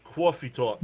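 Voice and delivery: "Coffee talk" as "cwaw-fee tawk"; New York accent. Shown as a demonstration. Playing up the teased accent for viewers, New York accent. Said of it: "I got teased about coffee".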